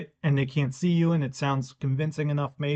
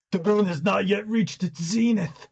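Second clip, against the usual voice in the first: pompous voice; 6 semitones higher than usual. Retold or imitated. Pompous wolf rule quote.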